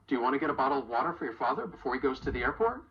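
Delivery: rapid, all reductions linked; quick American reductions; fast connected speech. Shown as a demonstration. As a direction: run the whole question together quickly. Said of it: "to create a pretty long sentence".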